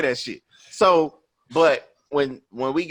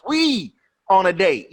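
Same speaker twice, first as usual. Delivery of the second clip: slow, higher-pitched indignant protest; retold anger; 8 semitones higher, 4 dB louder than usual. Man reminding his date they are out together, mad. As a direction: spell it out, annoyed, as in a retold argument. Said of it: "I was so mad after the movie".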